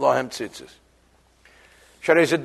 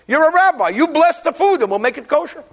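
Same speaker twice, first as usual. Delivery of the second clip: stewardess; 9 semitones higher, 4 dB louder than usual. Retold or imitated. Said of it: "Like the stewardess said to me".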